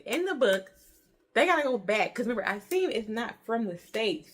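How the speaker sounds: ordinary talk, nothing marked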